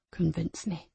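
low, soft, sinister command; menacing voice. Acted. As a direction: demand it quietly. Abuser demands proof.

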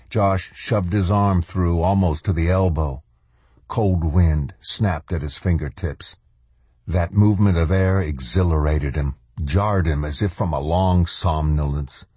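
ordinary delivery

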